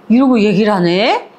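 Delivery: plain reading voice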